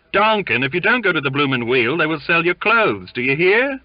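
high, loud taunting threat; jeering. A narrator's reading. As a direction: jeer at him across the room. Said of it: "jeered a third".

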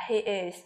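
low, soft falling "he is"; falling intonation. Shown as a demonstration. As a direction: drop "he is" down quietly. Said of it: "Chúng ta cũng xuống giọng".

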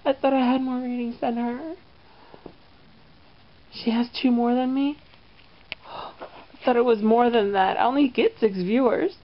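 high, indignant diva voice; a petty diva fuming over ratings; jealous talk-show host. Acted. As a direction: whine like a host losing in the ratings. Sketch voicing jealous talk host.

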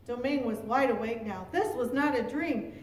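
plain reading voice

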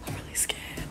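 real frightened whisper, barely voiced; scared whisper. Real, not acted. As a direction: whisper so the people outside can't hear. Hiding, scared of strangers outside.

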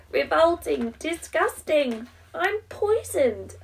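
high, loud, slow disgust; spitting out a foul taste. Acted. Cake tastes Rufus and flees.

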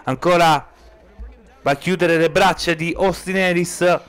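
ordinary talk

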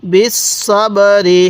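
slow, drawn-out বিস সব রি; model recitation. Shown as a demonstration. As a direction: hold each syllable of বিস সব রি, separately. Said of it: "এরকমভাবে শিখব".

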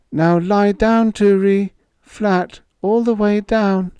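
put-on high, loud, trembling call of a girl; quavering call. A narrator's reading. Babs calling.

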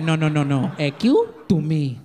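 put-on a light Spanish accent, slow on the last words; mother's Spanish accent. Mother yelling at her son in a light Spanish accent. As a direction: scold in broken English with a mild accent. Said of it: "She'd be like".

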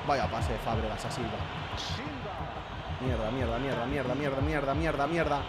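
muttered frustration. Real, not acted. Frustrated at the rival's pass.

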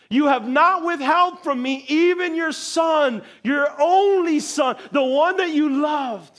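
slow, high, loud proclaiming; angel's voice. Retold or imitated. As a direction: declare it slowly like a heavenly messenger. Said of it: "the angel said".

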